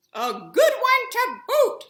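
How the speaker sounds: high, loud, slow chorus of pirates; pirate crew agreeing. A narrator's reading. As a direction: echo the captain as the crew. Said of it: "the others agreed".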